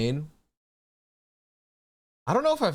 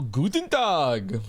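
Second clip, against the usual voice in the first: mock German greeting; much slower than usual. Shown as a demonstration. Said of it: "I've been practicing my German".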